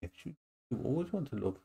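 soft, quick cooing to the dog. Real, not acted. Owner softly cooing to her clingy dog. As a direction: murmur it fondly to a pet.

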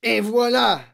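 loud, high cry of triumph; gloating shout; triumphant exclamation. A narrator's reading. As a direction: crow it like a victorious tyrant. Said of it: "s'exclama mademoiselle Legourdin, triomphante".

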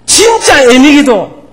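words breaking with real emotion; a preacher nearly weeping; choked up. Real, not acted. Preacher moved while preaching.

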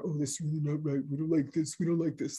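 a lightly voiced, quick, mocking whine from state officials; whiny state officials. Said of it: "This is my impersonation of the state of Minnesota D N R".

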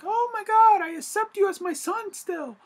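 very high, loud mock falsetto; mocking Aunt May. Mocking the young Aunt May accepting Peter, high falsetto. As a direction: squeal it out as a gushing young aunt. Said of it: "Aunt May would be like".

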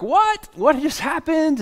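high-pitched, astonished squeal of a question; amazed and overjoyed, voice leaping way up; excited amazement. Acted. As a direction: act out the healed man's giddy disbelief. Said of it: "he's so excited and happy".

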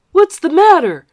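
loud, high demand; bully demanding an answer. Acted. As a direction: bark it at him. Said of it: "Spider demanded".

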